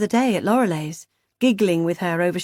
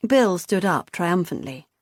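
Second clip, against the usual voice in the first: plain reading voice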